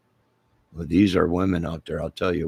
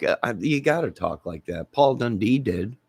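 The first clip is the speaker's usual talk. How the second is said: ordinary talk, nothing marked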